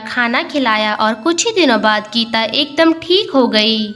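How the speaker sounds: ordinary delivery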